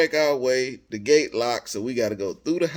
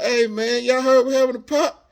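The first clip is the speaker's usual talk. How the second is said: excited gossip; 9 semitones higher, 5 dB louder than usual. Retold or imitated. Another stranger brings up Pop.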